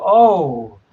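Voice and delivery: smooth, oily charmer. Acted. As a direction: ooze it out oily. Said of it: "with this oily voice".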